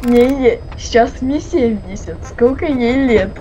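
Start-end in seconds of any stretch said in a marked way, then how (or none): none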